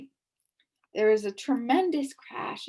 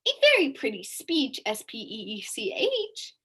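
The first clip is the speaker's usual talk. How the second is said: sneer; 4 semitones higher, 4 dB louder than usual. A narrator's reading. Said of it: "sneered the bee".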